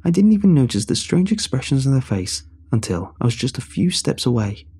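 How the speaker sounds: ordinary delivery, nothing marked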